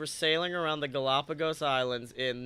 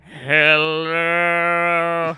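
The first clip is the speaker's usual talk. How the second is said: booming, much louder god voice echoing through the ocean; deep echoing sea voice; 12 dB louder, much slower than usual. Retold or imitated. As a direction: boom it like a god calling from the deep. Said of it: "a deep voice echoing throughout the ocean".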